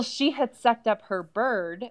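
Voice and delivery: usual speaking voice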